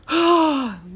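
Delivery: loud, high sigh to steady herself; emotional sigh. Real, not acted. Speaker collecting herself after nearly crying, loud emotional sigh. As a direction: let out a big, overwhelmed sigh.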